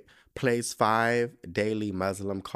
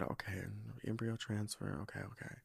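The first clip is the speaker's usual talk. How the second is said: quiet murmured repeating to herself; mom mumbling it back; 2 semitones lower, 15 dB quieter than usual. Retold or imitated. Mom softly murmuring the prayer list back. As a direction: mumble each item back softly as if noting it.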